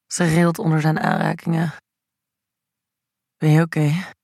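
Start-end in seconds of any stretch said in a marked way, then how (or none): 3.4-4.1 husky, close to her ear; hoarse. A narrator's reading. Anthony checks on Indy, hoarsely close to her ear. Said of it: "vraagt hij hees in haar oor".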